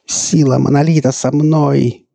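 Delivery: high, loud, slow proclamation; entranced, drawn-out cry; fanatical zeal. A narrator's reading. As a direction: proclaim it like a possessed zealot. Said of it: "произнёс эльф, широко раскрыв глаза".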